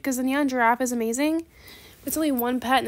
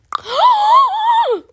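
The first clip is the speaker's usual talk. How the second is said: long, loud, high-pitched gasp; overjoyed gasp; 14 dB louder than usual. Pretend. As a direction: gasp hugely at an amazing offer. Overjoyed at pets in trade offer.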